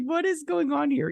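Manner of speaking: very high, incredulous squeal; bewildered outburst. Retold or imitated. Viewer reacting to the show, high and bewildered. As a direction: pitch it way up in comic disbelief. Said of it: "I'm just like".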